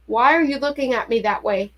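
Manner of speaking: usual speaking voice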